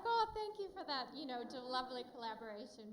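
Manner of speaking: high, mock-grateful Oh, then a quick dry finish; sarcastic thanks. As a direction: fake thanks to family who stayed warm. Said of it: "So I was like".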